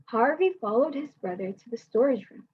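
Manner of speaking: ordinary delivery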